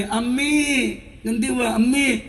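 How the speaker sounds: put-on high, small child's wail; lightly imitated child's cry; child crying for mother. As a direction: call for mom like a weeping toddler. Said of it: "అని ఏడుస్తూ ఉంటే".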